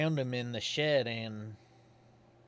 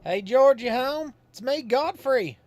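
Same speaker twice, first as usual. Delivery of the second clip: higher, louder country hail at a door; calling out folksy; 13 semitones higher, 10 dB louder than usual. Acted. Godfrey calls for George at his door, higher and louder. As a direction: call out to George like a friendly neighbour.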